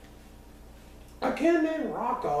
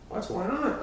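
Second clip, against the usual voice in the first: snapped; 9 semitones higher than usual. A narrator's reading. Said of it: "I snapped at my brother".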